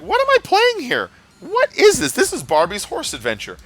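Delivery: incredulous. Retold or imitated. Mocking reaction to costume changes.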